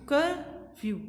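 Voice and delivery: "Curfew" as two separate beats, कर then few; split into syllables. Shown as a demonstration. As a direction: pause inside "curfew", between कर and few. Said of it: "हमने इसको टू part में बोला".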